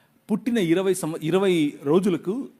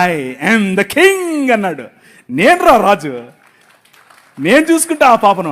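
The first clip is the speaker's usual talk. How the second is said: loud, very slow royal declaration; God's reply; 7 dB louder than usual. Retold or imitated. God answering the grieving father, loud and slow. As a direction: proclaim it like a king.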